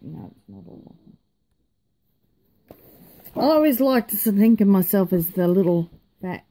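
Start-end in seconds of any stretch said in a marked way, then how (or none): 0.0-1.2 quick whispered aside; whispering. Real, not acted. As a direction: mutter it under your breath.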